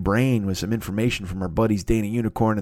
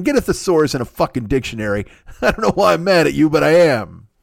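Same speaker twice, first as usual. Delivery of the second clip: usual speaking voice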